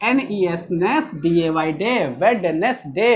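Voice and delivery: slow spelling chant, part by part; broken up for memorising. Retold or imitated. Madam teaches children to memorise, slow broken-up spelling. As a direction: spell out Wednesday slowly as wed-nes-day. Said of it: "तोड़ तोड़ के याद करो".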